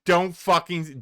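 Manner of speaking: loud, frantic pleading no; dreading shout. Real, not acted. Reacting streamer yells at the clip, begging him not to say it. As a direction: yell at the screen to stop him.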